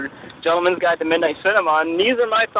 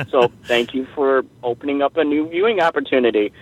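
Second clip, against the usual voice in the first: normal talking voice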